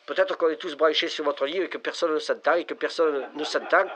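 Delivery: usual speaking voice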